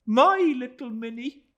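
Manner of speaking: high, loud tender mother's voice; Mrs Joram voiced. A narrator's reading. Mrs Joram telling David of Minnie, high and fond.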